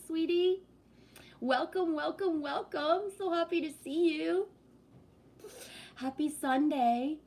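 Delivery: host greeting chat in a high sweet voice. Acted. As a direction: gush hello to arriving viewers. Greets chat viewers in sing-song.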